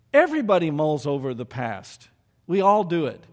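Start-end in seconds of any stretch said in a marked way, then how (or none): none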